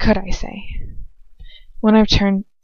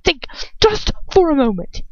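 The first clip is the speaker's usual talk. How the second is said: higher, rising interruption; voice raising; 8 semitones higher than usual. A narrator's reading. Lucius interrupting her with a raised voice. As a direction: cut her off, voice climbing. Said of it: "his voice raising".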